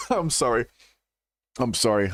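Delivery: usual speaking voice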